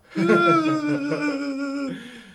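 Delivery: loud laugh. Real, not acted. Laughing at graduation talk.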